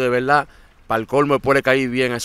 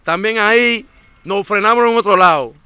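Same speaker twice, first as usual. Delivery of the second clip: loud, high-pitched telling; stressed telling of long hours crouched; excited exclaim; 9 semitones higher, 10 dB louder than usual. Real, not acted. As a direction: blurt it out, worked up. Excited telling of truck ride.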